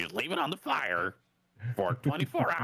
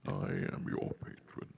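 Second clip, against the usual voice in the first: dark lord; 12 semitones lower, 7 dB quieter than usual. Acted. GM voices the warlock patron.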